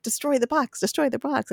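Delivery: high-pitched mock urgency; urgent pleading. Buffy signals Riley to smash it, urgent pleading.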